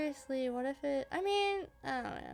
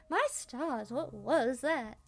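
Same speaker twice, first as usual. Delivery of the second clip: a light Southern drawl, slowed down; lightly voiced Southern belle asking; Southern accent; 4 dB louder, much slower than usual. Stella startled, in a light Southern accent. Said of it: "It helps me do the accent".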